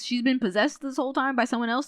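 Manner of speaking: ordinary talk, nothing marked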